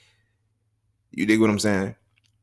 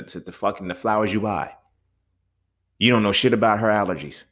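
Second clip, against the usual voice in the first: normal talking voice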